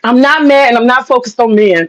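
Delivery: ordinary talk, nothing marked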